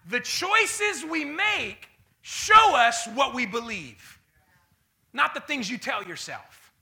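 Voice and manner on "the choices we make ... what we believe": drawn out and loud; slow and emphatic. Acted. Preacher hammering the point to the church. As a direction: lay each word down heavily.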